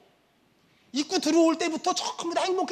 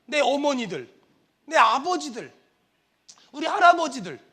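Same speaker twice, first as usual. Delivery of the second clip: normal talking voice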